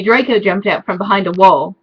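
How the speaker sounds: usual speaking voice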